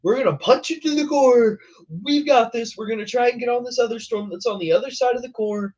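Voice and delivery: high, pumped-up mock chaser; fast, eager charge into the core; imitating the chaser's overconfident hype; hyped imitation. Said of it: "He's like".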